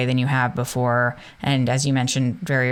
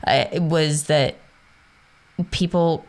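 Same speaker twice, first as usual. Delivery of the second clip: ordinary delivery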